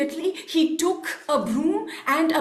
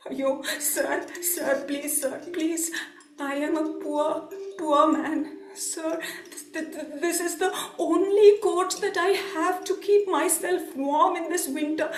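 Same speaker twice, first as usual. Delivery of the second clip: timid begging. Acted. Poor coolie begs merchant to spare his coat, timidly. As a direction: beg haltingly, afraid to offend.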